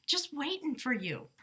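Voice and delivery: ordinary talk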